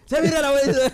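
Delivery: high, loud shouted punchline; joke voice. Retold or imitated. Telling the grandma joke to friends, loud character voice. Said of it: "Que se mira la abuelita y dice".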